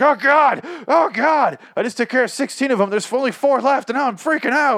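mock freak-out. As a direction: squeal it like an overacting hero. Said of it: "he's like".

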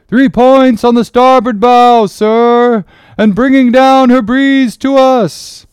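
high, sustained, full-voiced hail; loud sailor's report, held on a high pitch; lookout's shout. A narrator's reading. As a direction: call it down from the masthead.

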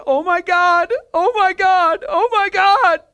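high, loud overwhelmed exclamations; high wailed repetition, climbing slightly; imitated tearful cry. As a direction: imitate a woman crying out, overcome. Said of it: "she started crying and she said".

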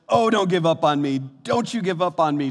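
mock-performed heartfelt pleading. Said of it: "Just pleading this".

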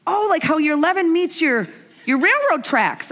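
put-on high kid voice piping up; mock boy's eager observation; imitating her son. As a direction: act an innocent child's bright idea, pitched high. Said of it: "And he goes".

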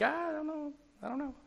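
high, soft, noncommittal waffle; mock hesitant. Preacher mimicking churchgoers when things are bad, mock hesitant. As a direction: imitate a reluctant dodge in a small high voice.

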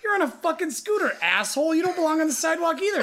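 high-pitched argument with a laugh under it; heated retort. Retold or imitated. Driver tells rider off, exasperated and amused. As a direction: re-enact telling him off, half laughing. Retelling his roadside argument.

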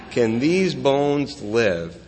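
very slow, each word laid down; slow, weighty question. Shown as a demonstration. Preacher repeating God's question to Ezekiel, very slow. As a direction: ask it slowly, letting it hang. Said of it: "repeating God's question slowly".

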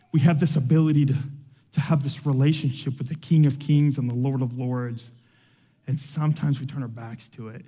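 ordinary delivery

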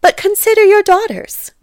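very high, loud protest; pleading wife. A narrator's reading. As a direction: implore your husband on the girls' behalf. Wife pleads in acted quarrel.

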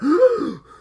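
loud high mock gasp; imitating the crowd's shocked gasp, loud and high. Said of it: "most gasp per episode".

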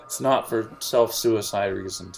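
ordinary delivery

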